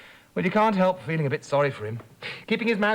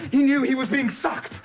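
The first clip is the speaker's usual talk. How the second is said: dramatic build; 8 semitones higher, slower than usual. A narrator's reading. Dramatic narration climax.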